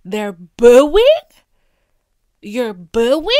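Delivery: loud stunned cry of disbelief; hurt shock at the booing, loud. Acted. Elon on stage hearing the boos, loud shocked disbelief.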